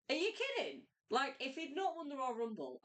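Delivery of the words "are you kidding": much higher, disbelieving; are you kidding, pitched way up; incredulous retort. Retold or imitated. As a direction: scoff at the very question. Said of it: "I'm like".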